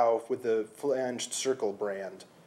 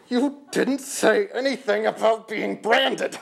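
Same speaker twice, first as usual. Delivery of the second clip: much higher, louder, slow protest; cried out; 11 semitones higher, 7 dB louder, slower than usual. Acted. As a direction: cry it out in alarm. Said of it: "I cried".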